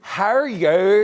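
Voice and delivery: copying a Northern Irish accent. Shown as a demonstration. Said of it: "Now you're better".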